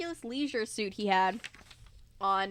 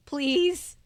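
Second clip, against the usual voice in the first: begging; 4 semitones higher, 8 dB louder than usual. Real, not acted. Begging the audience.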